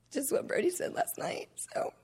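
laughing unvoiced whisper. Real, not acted. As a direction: whisper it as an aside.

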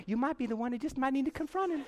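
high, soft mock-wife voice; a small high nagging echo in his head; imitating wife. Wife's remembered advice to her husband, high soft imitation. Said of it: "I hear my wife going".

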